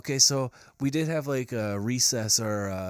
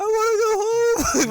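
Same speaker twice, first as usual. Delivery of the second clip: high, wailing fake sob; mock crying; 9 dB louder, slower than usual. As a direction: whine it like a homesick kid crying. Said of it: "We were like".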